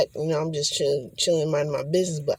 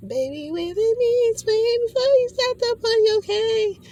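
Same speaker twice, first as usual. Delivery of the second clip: mocking falsetto spirit; 4 dB louder than usual. Spirit teasing the speaker, high mocking falsetto.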